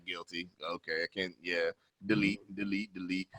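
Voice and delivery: very soft, low muttering; sheepish giving in. Retold or imitated. Man acting out clearing girls from his phone, sheepish. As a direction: mumble it in mock resignation.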